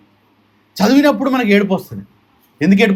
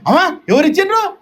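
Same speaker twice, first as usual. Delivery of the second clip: much higher, gushing put-on admirer; mock gushing praise; 10 semitones higher than usual. Preacher mimicking devotees gushing over a donation, high and admiring. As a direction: gush over the donor like an impressed devotee.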